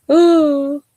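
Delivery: high, loud drawn-out ooh; a hammed-up spooky moan; mock spooky ooh. Real, not acted. Reacting to spooky joke.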